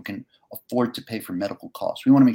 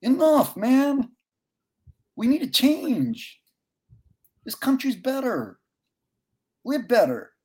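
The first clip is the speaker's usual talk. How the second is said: much higher, slow and insistent; impassioned plea; 3 dB louder, slower than usual. Real, not acted. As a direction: urge the country to do better. Emotional plea after the story.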